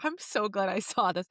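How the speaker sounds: ordinary talk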